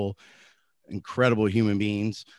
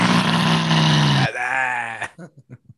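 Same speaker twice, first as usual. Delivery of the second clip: big shouted laugh; loud laugh; 4 semitones higher, 7 dB louder than usual. Real, not acted. Opening laugh after greeting.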